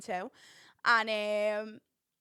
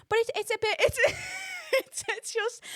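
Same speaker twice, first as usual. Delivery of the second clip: squeaky burst of laughter; breaking into laughter; 14 semitones higher than usual. Real, not acted. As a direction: lose it mid-sentence. Breaks into laughter mid-sentence.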